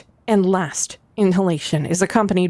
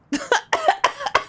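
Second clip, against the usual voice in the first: high squeaky giggle. Acted. Character laughs high.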